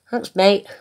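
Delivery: ordinary delivery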